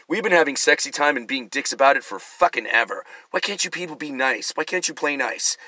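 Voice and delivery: usual speaking voice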